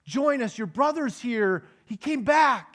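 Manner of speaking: a father pleading with his sulking son. Acted. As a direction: beg your son to come in, gently.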